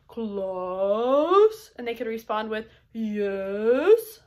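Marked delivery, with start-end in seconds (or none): 0.1-1.7 silly voice. Shown as a demonstration. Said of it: "different accents or different voices". 2.9-4.2 silly voice. Shown as a demonstration. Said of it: "different accents or different voices".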